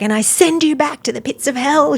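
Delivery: high-pitched, emphatic deliverance prayer; forceful retold banishing. Praying woman banishing mold to hell, emphatic and high. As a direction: imitate someone casting out evil with conviction.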